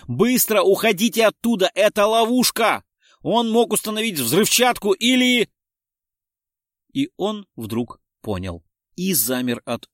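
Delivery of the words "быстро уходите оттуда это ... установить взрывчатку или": loud, high, frantic warning; shouted warning. A narrator's reading. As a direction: shout urgently about a trap, breaking off. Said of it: "прокричал в неё Дронго". (срочный крик об опасности)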